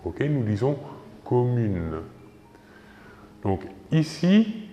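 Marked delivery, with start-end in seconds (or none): 1.2-2.0 "commune" finissant sur le son n; E final muet. Shown as a demonstration. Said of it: "que l'on ne prononce pas". (« commune », e final muet)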